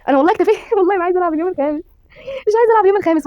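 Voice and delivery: high, laughing wail of protest; pleading refusal. Real, not acted. Player refusing to play a fifth day, pleading wail. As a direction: beg off loudly, pitched very high.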